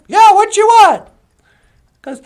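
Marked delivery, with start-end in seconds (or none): 0.1-1.1 loud, higher yelled reply; acted worker's holler; shouting back. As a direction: yell back over a jackhammer. Worker answers over jackhammer noise.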